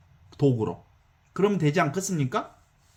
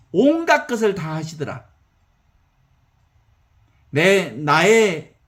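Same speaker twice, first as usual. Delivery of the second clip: normal talking voice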